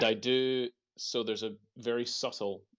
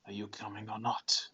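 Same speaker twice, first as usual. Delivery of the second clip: whispering; 3 dB quieter than usual. Acted. Calling quietly to freed slaves.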